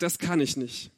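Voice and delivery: small doubtful voice; insecure refusal. Acted. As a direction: murmur it, defeated. Moses voicing his insecurity.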